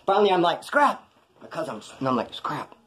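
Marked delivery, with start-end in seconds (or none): 0.6-1.0 calling out. Retold or imitated. Calling cousin from rooftop hiding. 2.3-2.8 hushed call of the name, much softer; quiet call. Retold or imitated. Calling cousin from rooftop hiding.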